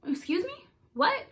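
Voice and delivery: high, incredulous questioning; retold stunned double-take; shocked reaction. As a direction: react in startled disbelief, pitch jumping up. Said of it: "That was my reaction".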